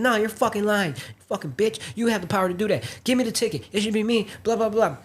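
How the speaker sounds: high, heated mock tirade; imitating a passenger going off at the agent; furious rant. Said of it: "she just started going crazy on her".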